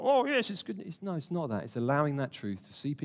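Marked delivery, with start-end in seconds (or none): 0.0-1.0 mock forced cheer, pitched higher; fake cheerfulness. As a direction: put on a manufactured smile, half-hearted. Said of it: "not about manufacturing smile".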